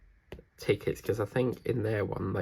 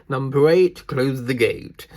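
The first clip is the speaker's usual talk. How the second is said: loud, high, slow bingo call; bingo caller; 5 semitones higher, 13 dB louder than usual. Pretend. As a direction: sing out the number to the hall. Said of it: "I could be a bingo caller".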